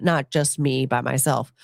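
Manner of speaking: ordinary talk, nothing marked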